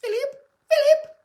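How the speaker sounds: high, plummy royal voice calling Philip; posh Queen voice. Pretend. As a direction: play the Queen summoning her husband. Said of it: "I don't know why I'm talking like that".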